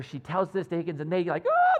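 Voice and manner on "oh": loud, high excited squeal of "oh"; mock excitement. Keeley and Higgins reacting to big news, so excited. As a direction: imitate two people bursting with excitement. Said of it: "they get so excited about it".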